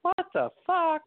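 high disbelieving outburst; shocked. Retold or imitated. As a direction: blurt it out in shock. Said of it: "I just remember watching it going".

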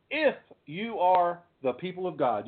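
"If" emphasized and held apart; heavy emphasis on the opening "If"; stress on IF. Shown as a demonstration. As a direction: hit "If", then carry on. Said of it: "I emphasize that word if".

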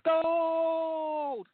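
long high held call of Gold; high drawn-out cry. Pretend. The Scotsman in the joke shouting for gold as he slides. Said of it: "he goes".

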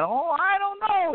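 high, drawn-out fake innocence; feigned uncertainty, pitched high; mock coy. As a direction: stretch it out, playing innocent way up high. Mimicking wrestler's coy act.

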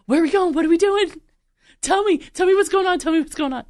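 high, loud eager dog voice; an excited dog begging to know the plan. Retold or imitated. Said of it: "Dogs are like".

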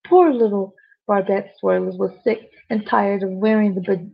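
usual speaking voice

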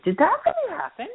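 can't believe what just happened; to her friend at brunch, incredulous. Retold or imitated. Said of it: "I said".

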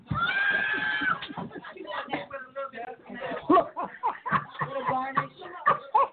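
high whooping laugh. Real, not acted. Laughing at own joke.

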